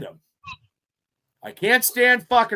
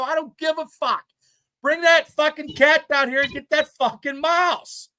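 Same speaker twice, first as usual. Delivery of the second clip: higher, half-shouted demand; mock panicked yell; 7 semitones higher than usual. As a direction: bark at her to bring the cat, panicking. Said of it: "I'm screaming like a little bitch".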